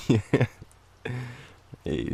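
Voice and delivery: usual speaking voice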